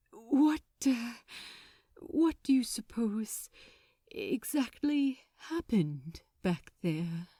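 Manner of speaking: hushed, breathy question, almost afraid to ask; nervous whisper. A narrator's reading. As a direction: whisper it, dreading the answer. Angel asks nervous question.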